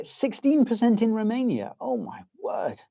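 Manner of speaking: high, incredulous read-out dropping in volume; real astonishment. Real, not acted. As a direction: pitch leaps up in disbelief. Reads shocking figure from chat.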